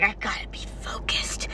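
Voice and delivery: hushed, serious whisper. Retold or imitated. Wizard Cookie reminding himself to focus, hushed whisper. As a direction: whisper it like a focused student in a library. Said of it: "but he was also like".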